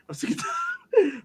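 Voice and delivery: high, slow fed-up wail; fed-up whine. Real, not acted. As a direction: wail it like you're at your limit. Ranting about Ben Simmons.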